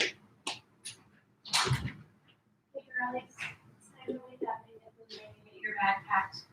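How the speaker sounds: soft, distant wake-up call; quiet. Real, not acted. Waking her daughters off to the side.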